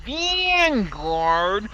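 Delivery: put-on slow, incredulous Brooklyn accent. Player questioning the goblin in a slow Brooklyn accent. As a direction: drag out the Brooklyn vowels. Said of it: "Why are you guys talking like this?".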